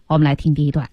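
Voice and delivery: normal talking voice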